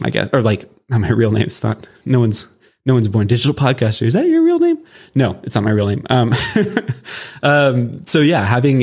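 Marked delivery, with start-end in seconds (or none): 4.0-4.8 a sudden high, curious voice of a stranger; imagined questioner. Pretend. Stranger asks the podcaster if that's his real name, high and curious. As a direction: play someone doubting the name, pitched way up.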